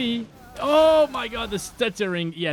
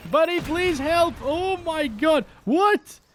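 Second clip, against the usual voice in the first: panicked calling out; 11 semitones higher, slower than usual. Real, not acted. Panicked calling to pal in game.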